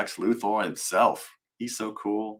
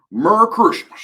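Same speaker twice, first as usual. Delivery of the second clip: Southern accent; 10 semitones higher, 11 dB louder than usual. Shown as a demonstration. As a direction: exaggerate a Southern holiday greeting. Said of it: "complete with Southern accent".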